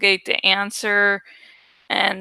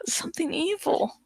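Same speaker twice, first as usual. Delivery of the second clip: eerie whisper; 7 semitones higher, 9 dB quieter than usual. A narrator's reading. Narrator's dread over evil word.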